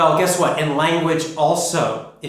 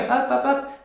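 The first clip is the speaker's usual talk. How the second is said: light, high-pitched "Up, up, up"; 11 semitones higher than usual. Shown as a demonstration. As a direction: say each "up" high in the voice. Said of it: "then we usually go up".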